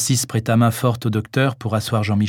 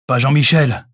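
flat, firm denial, a little louder; categorical; 3 semitones higher, 4 dB louder than usual. Acted. Francis insists his friend cannot be dead, categorical. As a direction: refuse to accept it, firmly. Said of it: "fit-il catégorique".

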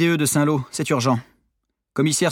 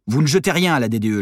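plain reading voice

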